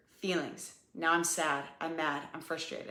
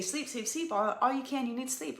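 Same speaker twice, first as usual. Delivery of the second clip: higher, pleading mom-to-mom advice; insistent advice; 7 semitones higher than usual. Retold or imitated. Telling a new mom what she should do, insistent and higher. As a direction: urge a tired mom to sleep, pitch up. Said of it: "like, I say".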